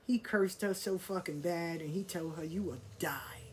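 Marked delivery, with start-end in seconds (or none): 2.4-3.4 low, breathy whispered threat; whispered curse. Retold or imitated. Haitian driver curses her out.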